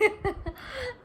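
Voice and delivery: short high giggle at herself; embarrassed laugh. Real, not acted. Laughing at her own absurd dream story for viewers. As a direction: laugh off how silly it sounds. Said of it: "आप सबको भी हंसी आ रही होगी".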